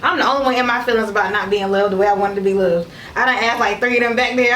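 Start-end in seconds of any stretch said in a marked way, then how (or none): none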